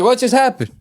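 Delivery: high-pitched mock bewilderment; hammed-up fright; mock panic. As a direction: imitate a startled man. Imitating the scared man's reaction.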